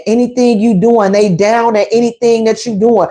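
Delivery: normal talking voice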